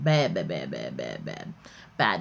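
trailing off into a low murmur; fading bad. Real, not acted. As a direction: let it die away under your breath. Chickens almost drowned, fading.

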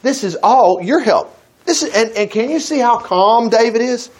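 usual speaking voice

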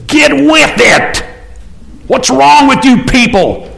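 mock drill instructor yelling at the people. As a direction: bark it like a DI at recruits. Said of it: "He's like a D I".

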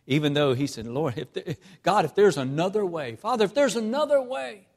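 loud, building sermon climax; preaching at full force. Acted. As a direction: drive it home from the pulpit.

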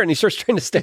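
ordinary delivery

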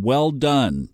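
normal talking voice

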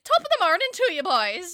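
high, loud hammed-up Irish mother greeting; mock Irish mom. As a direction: sing it out like a cheery Irish mum. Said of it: "She's like".

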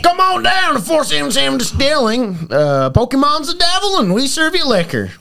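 high Southern preacher selling whiskey; Southern pastor ad. Acted. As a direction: hype it like a preacher doing a radio ad. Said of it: "can you just talk".

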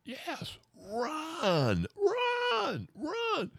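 very high, squealing mock panic; hammed-up high-pitched alarm; panicked cry. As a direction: cry out high, urging everyone to flee. Acted panicked cry to flee.

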